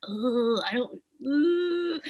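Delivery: reenacted whiny hesitation; reluctant groan. Urged to share her waters, groaning reluctance. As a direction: groan and squirm out of it.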